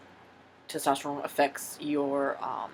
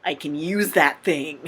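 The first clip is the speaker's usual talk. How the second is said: excited; 7 dB louder, slower than usual. Retold or imitated. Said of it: "'cause I'm like".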